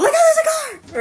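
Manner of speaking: high mock-alarmed shout; exaggerated panicked warning. Driver mimics passenger warning of a car, exaggerated yell. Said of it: "she said it in a calmer way".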